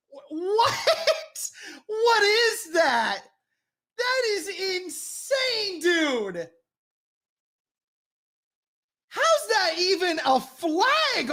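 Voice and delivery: high, slow, half-crying whine; real exasperated high-pitched moan; whiny high disbelief. Real, not acted. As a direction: wail at the ref like it's unbelievable. Disbelief at penalty call.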